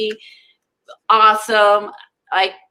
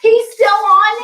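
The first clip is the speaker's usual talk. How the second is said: high, loud incredulous question; real surprise; 11 semitones higher, 6 dB louder than usual. Real, not acted. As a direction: jump up high and loud, amazed. Learns character is still on show.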